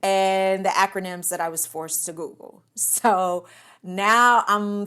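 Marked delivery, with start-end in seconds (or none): none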